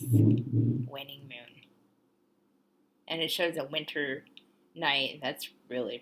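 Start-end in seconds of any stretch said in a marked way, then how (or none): 0.0-1.6 slow hushed whisper of the card title; whispered card name. Real, not acted. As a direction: breathe the name out reverently. Reads card name softly.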